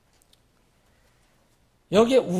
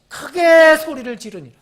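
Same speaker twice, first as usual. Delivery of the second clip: 크게 stretched out loud and high; stress on 크게; 8 semitones higher, 10 dB louder than usual. A narrator's reading. Reading out the verse's loud cry. (크게를 길고 크게 늘여 강조)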